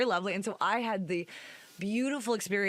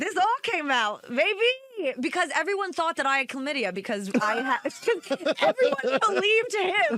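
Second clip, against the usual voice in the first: triumphant; 8 semitones higher, 5 dB louder than usual. Real, not acted. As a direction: gloat over the payoff. Triumphant vindicated telling.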